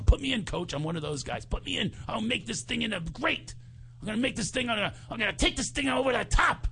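mock eager rookie; eager inner voice. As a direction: psych yourself up to go in. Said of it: "This is what I'm thinking to myself".

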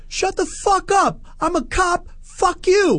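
high, strained cop impersonation; drunk cop. Retold or imitated. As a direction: imitate a worked-up drunk cop. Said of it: "he's been drinking".

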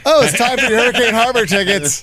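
mock radio DJ. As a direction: call out the caller number like a contest DJ. Parodies radio contest giveaway.

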